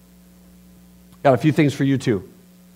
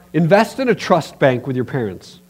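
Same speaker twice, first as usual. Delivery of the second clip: ordinary talk, nothing marked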